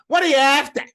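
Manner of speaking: loud, high broad Aussie bark; rough Australian bogan demanding an order; broad Australian accent. Retold or imitated. Maitre d' to confused diner, very broad Australian accent. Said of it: "in this very broad Australian accent".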